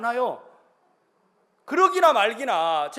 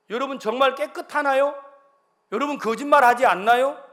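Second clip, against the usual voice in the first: pointed preacher's question; 3 semitones higher, 6 dB louder than usual. Real, not acted.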